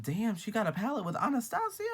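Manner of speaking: very high, incredulous question; retold astonished squeal of a question; surprised reaction. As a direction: pitch climbs way up in disbelief. Retelling own shocked reaction.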